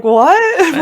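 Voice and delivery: loud, high burst of disbelief mixed with a laugh; a retold cry of surprise; shocked what. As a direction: blurt it out, stunned and half laughing. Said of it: "and I was like".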